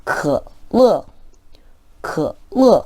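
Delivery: 반삼성 뒤 사성; third then fourth tone. Shown as a demonstration. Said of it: "삼성 뒤에 사성이 올 때".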